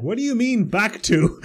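high, drawn-out incredulous retort; mock indignation. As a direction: imitate someone taken aback, stretching the words. Acted retort in a retold exchange.

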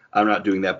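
normal talking voice

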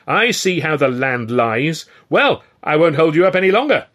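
loud, high, indignant tirade building to a finish; outraged declaration, loud and high; angry shouting. A narrator's reading. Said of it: "Ed shouted, his face turning puce".

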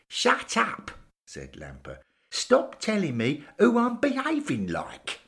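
angry retort. Acted. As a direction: tell him to shut up, fed up. Lamper snaps at Bill.

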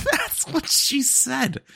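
high, slow laughing disbelief. Real, not acted.

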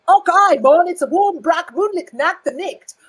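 high, loud hammed-up Scottish brogue; mock Scottish accent. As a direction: bellow it like a caricature Scotsman. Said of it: "what he says is".

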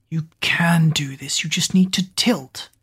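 ordinary delivery, nothing marked

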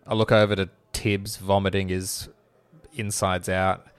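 ordinary talk

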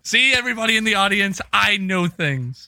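loud, high and slowed proud boast to the crowd. Real, not acted. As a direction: announce it like a triumphant show-off. Boasting to the audience.

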